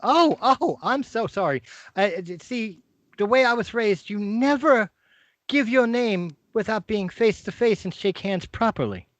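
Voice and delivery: put-on high, fussy old-fashioned stranger; prim and proper behind the door; a visitor insisting on manners before giving his name; polite visitor. GM voices the unseen visitor.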